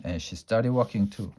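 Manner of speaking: usual speaking voice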